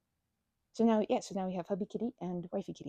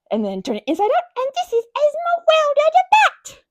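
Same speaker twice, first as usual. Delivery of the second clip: grand reveal; 13 dB louder than usual. Real, not acted. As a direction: unveil the bat with fanfare. Revealing the inside-out plushie.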